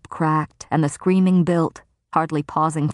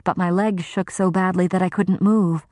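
ordinary delivery

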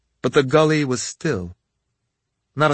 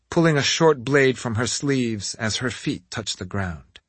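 usual speaking voice

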